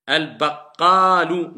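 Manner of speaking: Al-baqalu slow and loud, long vowel held; corrected reading. Shown as a demonstration. Teacher correcting the student, slow and loud. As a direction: stress the mad in Al-baqalu. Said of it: "Ne dites pas al-baqalu".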